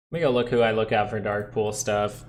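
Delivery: ordinary talk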